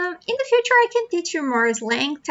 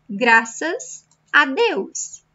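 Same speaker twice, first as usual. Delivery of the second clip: slow model phrase; slower than usual. Shown as a demonstration. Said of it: "teacher modelling phrase slowly".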